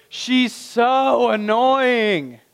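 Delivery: exasperated. Acted. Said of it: "In other words".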